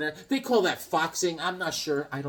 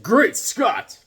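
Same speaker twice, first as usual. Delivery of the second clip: higher, louder heroic cry; a hint of cartoon Superman; Superman exclamation; 7 semitones higher, 7 dB louder than usual. Remembering Super Friends Superman, heroic cry. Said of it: "Superman always said".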